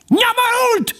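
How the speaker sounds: tiny, extremely high squeal; squealing weasel. A narrator's reading. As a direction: pipe the insult shrilly. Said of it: "sipította a menyét". (menyétként sipítva)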